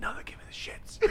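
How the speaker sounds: mock whispered reply; whispering. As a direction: whisper the refusal. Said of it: "You're like".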